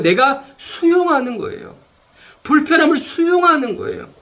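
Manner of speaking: high-pitched, driving sermon climax; impassioned preaching. Real, not acted. Preacher urging acceptance in Christ's love, impassioned. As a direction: press each point urgently on the congregation.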